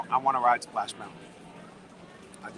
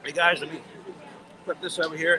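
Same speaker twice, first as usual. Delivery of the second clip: ordinary talk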